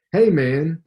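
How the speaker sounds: light hint of a Southern drawl, casual greeting; young boxer greeting a stranger, hint of Southern drawl. A narrator's reading. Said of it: "in his trademark Southern drawl".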